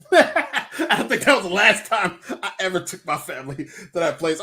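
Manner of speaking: talking through laughter; laughing. Real, not acted. Teller amused by own restaurant story, laughing.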